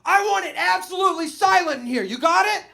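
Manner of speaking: loud, high bossy teacher voice; stern teacher. Retold or imitated. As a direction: bark it like a strict teacher facing a class. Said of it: "so he's like".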